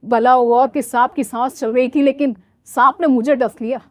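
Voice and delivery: ordinary talk, nothing marked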